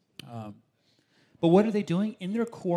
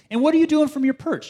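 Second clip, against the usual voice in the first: sharply higher and louder, thrilled question; excited high call; 13 semitones higher, 11 dB louder, faster than usual. Real, not acted. Excited rhetorical question to congregation.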